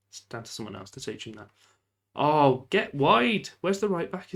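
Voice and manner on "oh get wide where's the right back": loud urgent shout at the team; calling out. Real, not acted. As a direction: yell at the players to spread wide. Calling out to his players.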